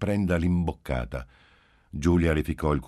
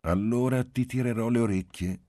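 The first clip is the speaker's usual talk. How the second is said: light, higher teasing threat; playful father, pitched up a little; 6 semitones higher than usual. A narrator's reading. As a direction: tease the child with a mock threat. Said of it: "disse Marcello giocosamente".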